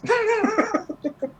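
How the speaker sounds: a sudden high-pitched laugh; high burst of laughter. Real, not acted. Storyteller cracking up at his own story, high laughter.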